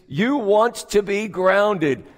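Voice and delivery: ordinary delivery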